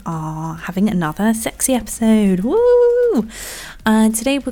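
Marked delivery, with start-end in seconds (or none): 2.4-3.3 high, gleeful woo; excited whoop. Real, not acted. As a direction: cheer it up high like a party. Hyping up sexy episode.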